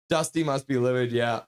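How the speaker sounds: ordinary talk, nothing marked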